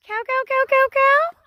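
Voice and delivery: high, rapid repeated cattle call; calling cows. Real, not acted. Calling cattle over a fence.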